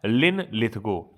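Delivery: slow, drawn-out लिन Lithgow, each part set apart; teacher drilling the name slowly; slow dictation. Shown as a demonstration. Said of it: "रटे रहिए कि".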